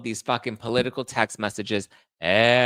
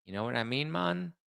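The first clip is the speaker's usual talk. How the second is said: softer mock Caribbean accent, lifting on Mon; 3 semitones higher, 7 dB quieter than usual. Host mimicking the candidate's accents, mock Caribbean. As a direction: toss it off in a fake Caribbean accent. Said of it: "Those are our accents".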